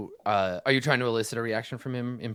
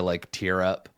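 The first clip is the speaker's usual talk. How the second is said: ordinary talk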